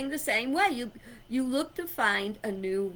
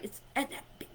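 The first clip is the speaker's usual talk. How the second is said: whisper; 10 dB quieter, much faster than usual. Shown as a demonstration. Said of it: "Big one".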